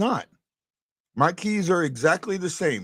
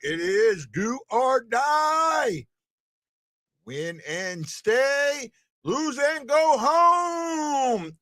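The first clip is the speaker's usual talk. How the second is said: hyped announcer; 8 semitones higher, much slower than usual. Real, not acted. Hyping elimination game day.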